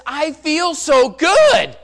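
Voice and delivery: high, very loud, drawn-out cry; tearful joy, retold. Boy telling preacher of his relief, loud elation. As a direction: imitate a boy bursting with relief. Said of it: "he starts crying again".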